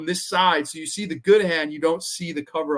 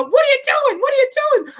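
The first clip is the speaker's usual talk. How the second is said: very high, loud squeaky panic; shrill panicked creature; 7 dB louder than usual. Retold or imitated. The brother creature panics at Don Rickles, squeaky high voice. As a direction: squeal it like a frantic little monster. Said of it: "I have this fucking creature".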